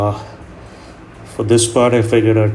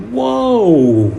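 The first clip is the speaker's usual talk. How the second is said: high, loud startled whoa; surprised whoa; 11 semitones higher, 3 dB louder than usual. Retold or imitated. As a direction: react like a punch of hot steam. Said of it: "you're like".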